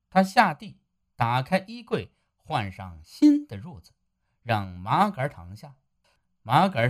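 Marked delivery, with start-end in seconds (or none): none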